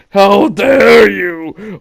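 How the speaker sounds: loud, slow, outraged gasp of a line; mock offended shriek; indignant Karen. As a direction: play the shocked entitled shopper. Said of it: "She opens her mouth to say".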